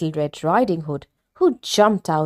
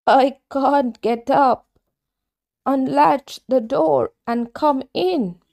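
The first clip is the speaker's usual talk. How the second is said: put-on higher, slow old lady voice; a frail granny calling from bed; old grandmother; 6 semitones higher, slower than usual. Grandmother telling the visitor to come in, slow and frail.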